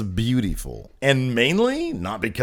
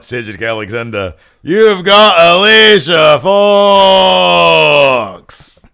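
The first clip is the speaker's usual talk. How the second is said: Noam Dar impression; 8 dB louder than usual.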